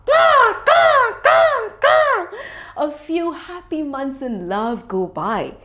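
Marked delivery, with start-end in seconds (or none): 0.1-2.3 high ka ka crow calls; crow caws. Acted. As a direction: call out like a crow. Happy crows calling.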